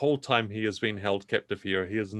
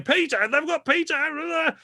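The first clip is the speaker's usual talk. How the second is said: much higher, slow alarmed cry; alarmed brother; slower than usual. Acted. A brother discovering Peter was taken, high and slow. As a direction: cry out in dismay. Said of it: "someone's saying".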